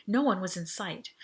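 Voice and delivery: ordinary delivery, nothing marked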